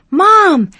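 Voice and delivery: loud, high yell across the house; a boy bursting into the kitchen shouting for mom. A narrator's reading. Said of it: "Jeremy yelled".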